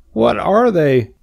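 high, slow pig whine; sad. A narrator's reading. Pig asks what he lacks, sad. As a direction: ask forlornly as a hurt little pig. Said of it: "asked Wilbur sadly".

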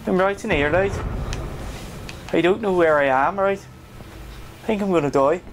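put-on Birmingham accent; Brummie accent. Player joking to viewers, Brummie accent. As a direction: play it as a lilting Brummie. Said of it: "That was my Brummie accent".

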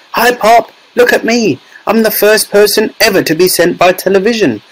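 put-on high mouse-squeak boy; tiny little voice. A narrator's reading. Shrunken boy greets mother from television, tiny squeaky voice. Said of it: "A tiny little voice".

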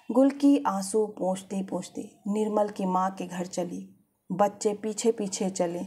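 ordinary delivery, nothing marked

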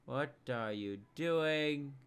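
slow, stretched-out question; slow, incredulous challenge; drawn-out questioning. Real, not acted. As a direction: drag each word out in disbelief. Repeats the question slowly.

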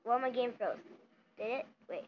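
normal talking voice

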